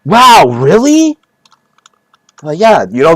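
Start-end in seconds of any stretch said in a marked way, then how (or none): 0.0-1.2 mock amazed. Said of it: "Be like".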